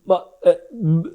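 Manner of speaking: imitated tongue-tied stammer; re-enacting being too choked to form words; words won't come out. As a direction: try to speak and get stuck on broken syllables. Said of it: "I was like that".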